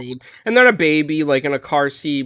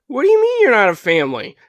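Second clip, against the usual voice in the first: higher, louder, disbelieving challenge; incredulous; 8 semitones higher, 4 dB louder than usual. Retold or imitated. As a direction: push back, can't believe it. Retold argument in GameStop line.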